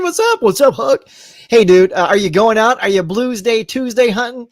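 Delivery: usual speaking voice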